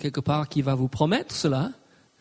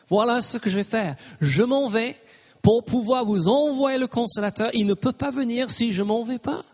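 lifted, higher preaching delivery; preaching with rising fervour; raised preaching voice; 6 semitones higher than usual. Acted. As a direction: carry Jesus's words up high. Preacher voicing Jesus's words.

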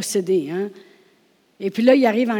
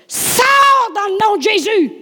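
much higher, louder, slow shouted command; loud cry; 14 semitones higher, 9 dB louder, slower than usual. Retold or imitated. Preacher rebuking the spirit in her sick daughter, shouting. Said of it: "j'ai crié fort".

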